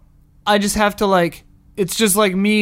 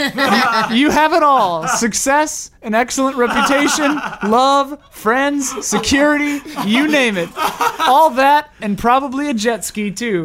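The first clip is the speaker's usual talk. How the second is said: louder and higher, breaking into laughter; excited fortune reading; 5 semitones higher, 4 dB louder than usual. Shown as a demonstration. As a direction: deliver the fortune with growing delight. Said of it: "it's the same".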